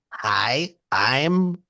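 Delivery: slow, drawn-out put-on greeting; trying not to sound normal; disguised voice. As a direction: disguise your voice, stretching each word. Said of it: "a disguising my voice".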